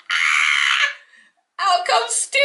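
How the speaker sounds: shout, then a high sing-song announcement; excited. Retold or imitated. Fan reliving Sting's arrival, shouting then singing out. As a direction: burst out then sing his entrance. Retelling shock at Sting's entrance.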